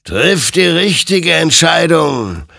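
loud, high, drawn-out warning; stern admonishment. A narrator's reading. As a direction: admonish slowly and forcefully as a spectre. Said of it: "ermahnte ihn sein Gegenüber". (laut und gedehnt ermahnend)

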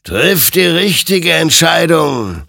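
loud, high, drawn-out command; ringing, stern admonition; admonishing. A narrator's reading. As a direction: exhort slowly like a commanding spirit. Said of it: "ermahnte ihn sein Gegenüber".

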